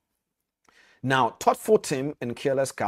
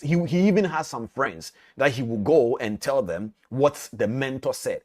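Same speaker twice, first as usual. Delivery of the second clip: ordinary delivery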